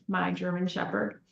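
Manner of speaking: usual speaking voice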